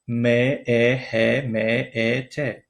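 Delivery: each letter said separately with small gaps; spelled letter by letter. Shown as a demonstration. Teacher demonstrates spelling Mehmet to learners, letter by letter. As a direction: spell the name out evenly, one letter at a time. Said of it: "اما این را باید که با، اه، حرفکی گوییم".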